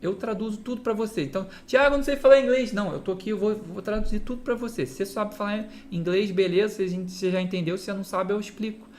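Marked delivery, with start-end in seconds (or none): 1.7-2.7 mock student objection, higher and louder; imitated pupil piping up, loud and quick; protesting student. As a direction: blurt it out fast as a worried pupil's complaint.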